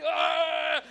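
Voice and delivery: loud, high mock roar; gorilla roar. Costumed gorilla ignoring the leader, loud roaring. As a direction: roar right over the shouting. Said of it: "try to really be a big, bad gorilla".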